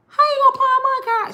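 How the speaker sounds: high, loud disbelieving squeal; loud, high mock outrage; outraged squeal. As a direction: shriek it in outrage. Outraged retort to sister.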